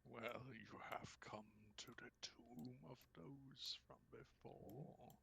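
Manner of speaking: soft, breath-only menace, slowing down; ghostly whisper. Acted. Tomb spirit greets intruder in the tomb, whispering. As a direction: whisper a slow, eerie welcome.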